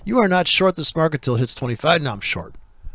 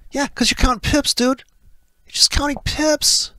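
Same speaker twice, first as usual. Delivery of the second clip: mocking; 11 semitones higher, 3 dB louder than usual. Mocking pip-counters in a high voice.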